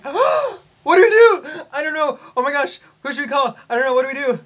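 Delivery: mock panic. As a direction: squeal it like a pretend panic, half laughing at yourself. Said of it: "And we're just like".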